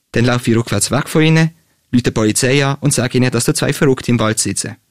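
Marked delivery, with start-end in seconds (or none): none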